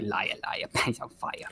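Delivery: whispered spiteful taunt; spiteful whispered mumble. Acted. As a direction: mutter it under your breath, spiteful. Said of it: "mumbling to himself a little spitefully".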